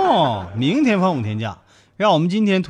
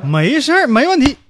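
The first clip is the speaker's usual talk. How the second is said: high, loud eager yes; eager promise; 9 semitones higher, 7 dB louder than usual. Acted. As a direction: promise it proudly, chest out. Boyfriend promising on phone.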